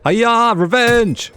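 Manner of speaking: high, drawn-out attack yell; playful martial-arts cry as you strike; mock battle cry. Gamer striking back at the pillager, mock battle cry.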